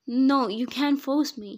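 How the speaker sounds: high, loud, slow shout; shouted protest. A narrator's reading. Bride protests the forced marriage, shouting. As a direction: shout it out, dragging the words in defiance. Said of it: "she shouted".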